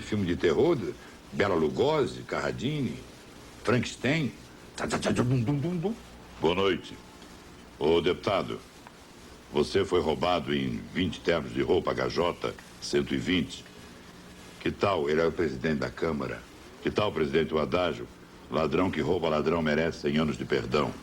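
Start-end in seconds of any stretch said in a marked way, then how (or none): 4.8-5.9 sung-out spooky movie music sting; hummed horror theme as an intro; vocal horror music. Retold or imitated. Imitating horror film soundtrack. 6.4-20.9 lower, gruff interrogator tone; grave TV interviewer voice grilling a congressman; rough, grave voice. Retold or imitated. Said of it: "áspera, grave".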